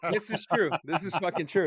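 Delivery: high-pitched, through real laughter; laughing admission. Real, not acted. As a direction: concede it while still cracking up. Laughing as he admits the joke.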